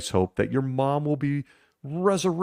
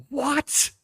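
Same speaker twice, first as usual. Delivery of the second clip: shocked. Real, not acted. Shocked reaction after skit.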